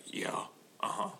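whispered gravelly mumble. Retold or imitated. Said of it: "and he's like".

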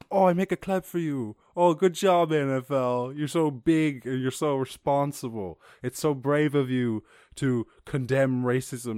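sarcastic praise. Acted. Vlogger mocks the NFL's statement, loud sarcastic praise. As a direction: gush with fake admiration, laying it on thick.

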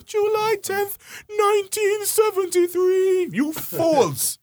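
high, slow, sneering; mock them in a high scornful voice. Real, not acted. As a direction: derisive outburst at the leaders.

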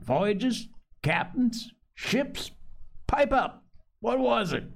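high-pitched string of prodding questions; demanding. A narrator's reading. Silver interrogates Morgan about the talk, demanding. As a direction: press for an answer, pitch climbing.

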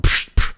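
loud unvoiced punch noises; imitated punch sounds. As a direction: make quick airy punch hits.

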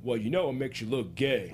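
booming put-on big-man voice; lightly voiced bluntness. Mark Henry quoted, different timbre.

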